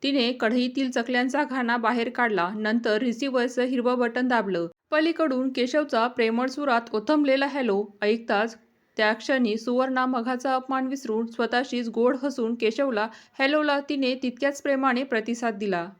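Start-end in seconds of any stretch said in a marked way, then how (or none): none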